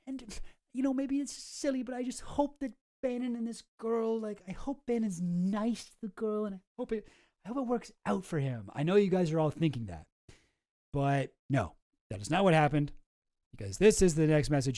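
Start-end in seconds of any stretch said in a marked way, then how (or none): 0.1-8.7 mock sentimental. Continuing mock sentimental hope.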